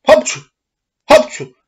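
big high sneezes cutting off speech; two sneezes. A narrator's reading. Puppeteer sneezing mid-sentence, loud high sneezes. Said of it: "Și mai strănută de două ori".

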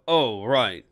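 ordinary delivery

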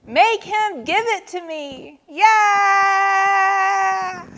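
high demand rising into a loud wail; screech, then let the last word wail. A narrator's reading. Turtle demanding Walrus make Vulture share, screeching. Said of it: "screeched Turtle".

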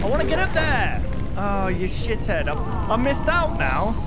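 frustrated yell. Real, not acted. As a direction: shout at the game as it goes wrong. Frustrated at game failure.

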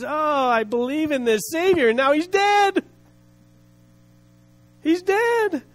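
acted high wail of grief; weeping bitterly. Preacher imitating the officer grieving his Savior, tearful lament. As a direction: lament it, pitch climbing high on each cry. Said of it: "weep bitterly".

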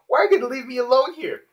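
hammed-up tearful wail; mock crying. Lucy, left alone by Natsu, crying out tearfully. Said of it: "she's crying".

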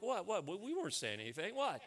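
high, swooping startled "what"; comic feigned disbelief, pitch leaping up; mock shocked. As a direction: act caught out and flustered, comically. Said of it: "that must have shocked them".

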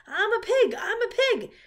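high, loud show-off shouting; excited high-pitched yelling; boyish yell. A narrator's reading. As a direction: yell it like a silly boy. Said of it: "yelled Avery".

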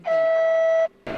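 stretched excited yell. Real, not acted. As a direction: stretch it out in a big excited wail. Game is tricky, voice jumps.

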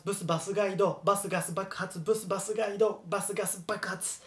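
rapid repeated drill, fading toward the end; quick, continuous tongue-twister repetition. Shown as a demonstration. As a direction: keep racing through each round. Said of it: "hablar rápido".

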